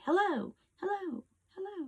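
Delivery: high echo fading away; echo imitation. Shown as a demonstration. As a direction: mimic a canyon echo dying off. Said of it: "It comes back to you like".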